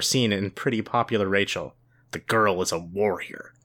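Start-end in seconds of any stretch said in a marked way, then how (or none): 2.1-3.5 low, slow, weighty line; dramatic narration. A narrator's reading. Narrator revealing Rachel's fierce side, grave.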